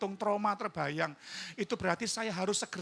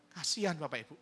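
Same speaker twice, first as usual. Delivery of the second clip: usual speaking voice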